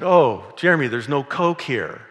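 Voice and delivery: mild whiny plea about the missing Coke. Retold or imitated. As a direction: whine a little, drawn out. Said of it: "I whined a little bit".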